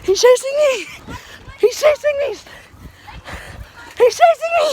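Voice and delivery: high, loud, panicked repeating; being chased. Real, not acted. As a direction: cry out as he closes in.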